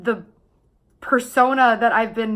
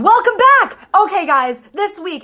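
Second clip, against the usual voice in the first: much higher, louder, hyped-up host voice; YouTuber intro voice; 9 semitones higher, 6 dB louder than usual. Retold or imitated. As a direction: caricature of a bubbly vlogger greeting. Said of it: "doing a caricature of my twenty-two-year-old self".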